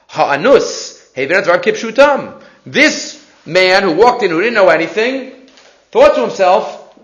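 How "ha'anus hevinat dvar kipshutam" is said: usual speaking voice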